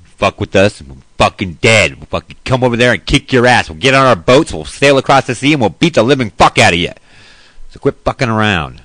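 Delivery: loud, aggressive threats; bully the enemy into backing down; manly tough voice. Retold or imitated. Showing how a tough president threatens other countries, loud and aggressive. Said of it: "We'll say that".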